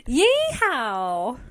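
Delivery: loud, high cowgirl holler in a Southern twang; Southern yeehaw. Pretend. Host cheering the episode's start, in a playful Southern holler. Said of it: "doing a Southern accent".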